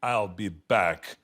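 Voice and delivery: low, slow, deliberate movie delivery; movie catchphrase. Acted. Star obliges a park fan, low movie delivery. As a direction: stretch it out low like the famous film line. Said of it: "the way you said it in the movies".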